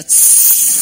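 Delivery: short unvoiced hiss through the teeth. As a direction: hiss at the screen. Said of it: "somebody will go".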